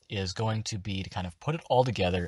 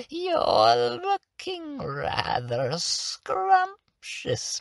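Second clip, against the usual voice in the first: finished hag voice; 4 semitones higher, 4 dB quieter, much slower than usual. Shown as a demonstration. Hag sweet-talking a player to get a finger, sing-song crone. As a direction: croon sweetly, savouring your prey. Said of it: "it's gonna sound a little bit like this".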